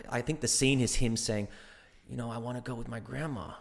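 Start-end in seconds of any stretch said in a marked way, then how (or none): 2.3-3.5 put-on soft American-accented mumble. Spider-Man actor plays a scene, soft American accent. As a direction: quietly imitate an American accent. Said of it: "incredible American accent".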